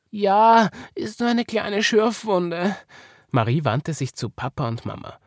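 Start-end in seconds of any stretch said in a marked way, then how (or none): 0.1-2.7 put-on high child voice, slowing down; raised, drawn-out childlike reply; little girl. A narrator's reading. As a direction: answer as a child just hurt. Marie answers, shaken after fall.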